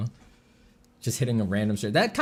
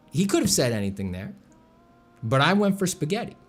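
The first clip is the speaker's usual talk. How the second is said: ordinary delivery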